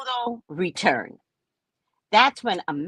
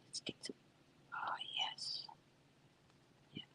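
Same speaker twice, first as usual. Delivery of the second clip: whisper; 24 dB quieter than usual. Real, not acted. Whispering while doing makeup.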